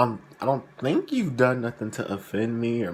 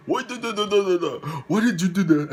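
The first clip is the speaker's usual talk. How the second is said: scolding man imitated; 9 semitones higher, faster than usual. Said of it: "and he's like".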